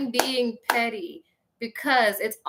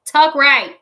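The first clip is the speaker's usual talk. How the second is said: louder, higher exasperated order; loud scolding; 5 semitones higher, 11 dB louder than usual. Acted. Scolding a grown woman for baby talk, loud order. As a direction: punch it out as a reprimand.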